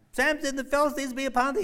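high, loud warning call; alarmed cry. Acted. Delilah crying out the alarm again, loud. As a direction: shout it at Samson.

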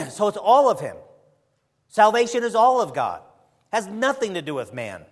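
preaching. Acted. Preaching at full force.

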